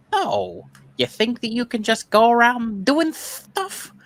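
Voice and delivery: very high, slow, drawn-out pet voice; silly high-pitched talking dog; Charlotte the dog. Acted. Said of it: "great voice that I do for Charlotte".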